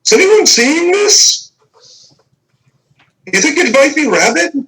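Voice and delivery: astonished villager. Acted. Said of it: "He's like".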